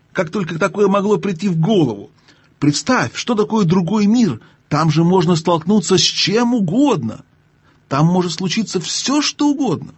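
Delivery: high-pitched, flustered indignation; indignant protest. Acted. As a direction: protest in alarm, pitch climbing to the end. Offended uncle protesting the idea.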